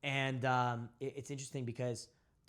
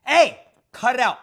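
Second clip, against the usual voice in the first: much louder, slow, firm command; commanding shout; 11 semitones higher, 21 dB louder, slower than usual. Shown as a demonstration. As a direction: snap her back to reality. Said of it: "through my tonality".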